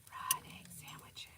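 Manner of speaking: breathy whispered aside. Real, not acted. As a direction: whisper it like a secret.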